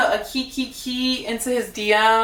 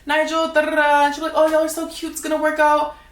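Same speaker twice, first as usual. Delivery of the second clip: higher, gushing mock of a fake friend; fake sweet friend; 6 semitones higher, faster than usual. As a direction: imitate her sugary fake support. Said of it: "She'd always check in and be like".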